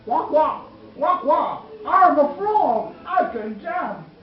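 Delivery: silly frog voice. Acted. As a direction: boast like a bouncy cartoon frog. Mother voices frog for child.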